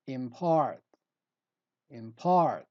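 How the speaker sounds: "impart" with a strong second syllable; light "im", then punch "part" in "impart"; stress on PART. Shown as a demonstration. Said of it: "We stress the part".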